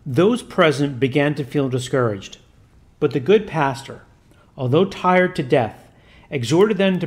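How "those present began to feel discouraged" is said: usual speaking voice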